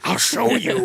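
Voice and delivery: high, theatrical villain's threat; terrifying warlock. Acted. Warlock promises to show his foes, high hammed-up menace. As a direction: put on a crazed warlock's menace. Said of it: "some kind of terrifying, like, warlock".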